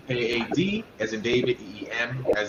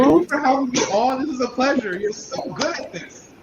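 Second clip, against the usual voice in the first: high, loud, gushing gratitude; excited thanks; 12 semitones higher, 6 dB louder than usual. Real, not acted. Guest thanking the host at the close of the show, excited. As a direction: thank the host with delighted, raised energy.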